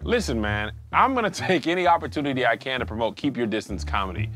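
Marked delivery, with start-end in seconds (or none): none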